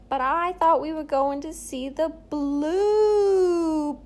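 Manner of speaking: put-on high, loud old-timey Jersey accent, stretching the end slowly; 1950s Jersey voice. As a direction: ham up a fifties Jersey guy. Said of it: "nineteen fifties Jersey voice going".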